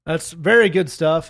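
slow, booming announcer delivery. Real, not acted. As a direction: stretch it out like a ring announcer. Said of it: "I'm having a hard time shifting out of announcer voice".

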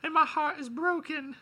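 squeaky mock caller; high-pitched caller voice. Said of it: "someone calls in".

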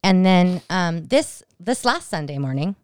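ordinary talk

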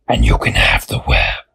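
very low, whispered spider offer. A narrator's reading.

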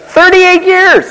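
can you believe it, way up high; "Thirty-eight years" in high incredulous squeal. Acted. Laughing disbelief at the number.

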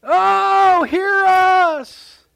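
loud high wailing chant to Baal; desperate priests of Baal shrieking to their idol; frenzied pagan cry. Retold or imitated. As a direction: cry out to a deaf god at full pitch. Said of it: "They begin to chant".

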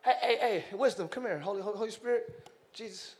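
God voiced low and relaxed; God calling the Son. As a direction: beckon them over, casual and fond. Preacher voices God calling the Trinity.

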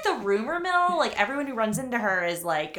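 ordinary talk, nothing marked

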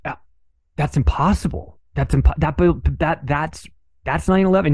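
retold stammering concern. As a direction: imitate a man stumbling over his words in rising worry. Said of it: "concerned, and he starts".

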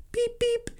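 very high soft "beep, beep"; a dainty little horn. Retold or imitated. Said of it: "one little dainty little horn".

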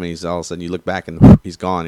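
ordinary delivery

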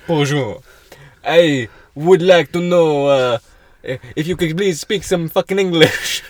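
high, loud, slow hammed-up Italian-sounding accent; put-on European accent, drawn out and pushy; mock Italian-ish accent. Mocking a rude tourist in a hammed-up Italian-sounding accent. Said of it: "I think that's more Italian".

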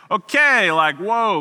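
high, loud startled backpedal; mock taken aback. Tickler reacting to a small boy's vow, mock startled. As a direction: jump up in pitch, playing startled.

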